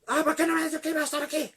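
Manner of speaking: high, loud, rushed question; mortified panic. Retold or imitated. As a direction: act out someone caught out and embarrassed. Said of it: "de vergüenza, se escapó". (pregunta avergonzada, aguda, fuerte y apresurada)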